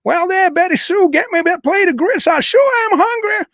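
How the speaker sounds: high, slow hammed-up Southern accent; fake Southern drawl. Host pranking his co-host as a Southern ghost, hammy Southern accent. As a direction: play a hungry Southern ghost, Southern accent laid on thick. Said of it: "let's see if a Southern voice happens".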